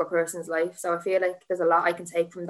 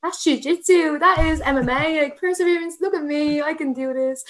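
higher, pumped-up inner voice; psyching herself up before teaching, pumped and higher; self pep talk; 8 semitones higher than usual. Retold or imitated. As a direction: hype yourself up like a fighter. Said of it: "in my head, I'm like".

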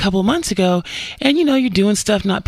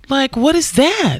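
normal talking voice

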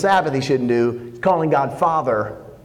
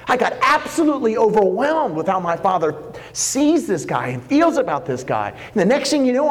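higher-pitched, fervent sermon delivery; heartfelt pulpit proclamation, pitched up; impassioned preaching; 6 semitones higher than usual. Acted. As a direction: preach it with rising urgency, speaking as Jesus. Preaches as Jesus explaining Himself.

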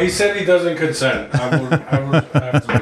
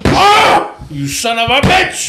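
mock pained shout; 8 semitones higher, slower than usual. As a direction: shout like you just got hurt.

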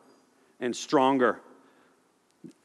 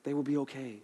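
much softer, a hushed, overly meek Jesus; mock gentle Jesus; 2 semitones lower, 15 dB quieter than usual. Preacher mocking the soft Jesus people imagine, hushed. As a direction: coo it like a soft-hearted pushover. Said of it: "think Jesus, like".

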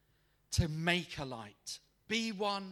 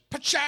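loud, high burst for a door flying open; explosive "pa-chah" door noise; sudden crashing door sound effect; imitated door bang; 10 semitones higher, 12 dB louder than usual. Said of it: "the door just goes".

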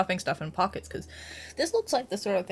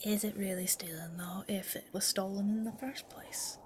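whispering; 7 dB quieter than usual. Acted. Player whispers back to the voice.

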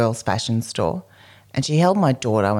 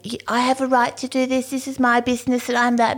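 high haughty woman voice, imitated; impersonating the entitled shop lady; mock snooty shop owner; 11 semitones higher than usual. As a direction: lift it high and prissy, mocking her.

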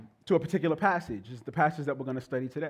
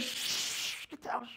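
much softer hissed hush; hushed mom; 7 semitones higher, 12 dB quieter, much slower than usual. Retold or imitated. Mother hushing the family as Jehovah's Witnesses arrive, urgent hush. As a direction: hush everyone down now. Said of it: "she's already downstairs".

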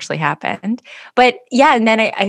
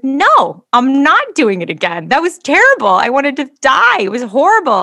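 loud, higher "no" and protest; adamant refusal; 7 semitones higher, 6 dB louder than usual. Retold or imitated. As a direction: refuse flatly, voice raised. Refusing the retest.

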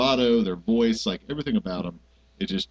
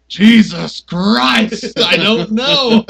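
higher, louder, dragged-out exasperation; exasperated outburst; 7 semitones higher, 7 dB louder, much slower than usual. Retold or imitated. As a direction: blow up slowly in mock frustration. Acted couple quarrel.

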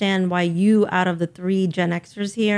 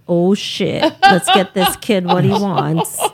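a lightly done bad Italian accent, mobster style; 3 dB louder, slower than usual. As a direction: play it as a hammed-up Italian mobster, not very far from your own voice. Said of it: "my bad Italian accent".

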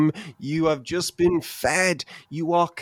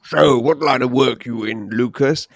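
gruff uncle voice; 5 dB louder than usual. Retold or imitated. Vernon voiced quizzing Lucas.

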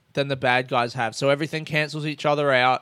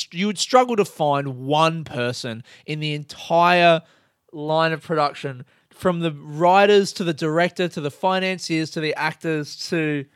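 normal talking voice

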